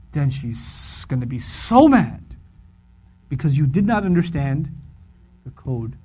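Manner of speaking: ordinary talk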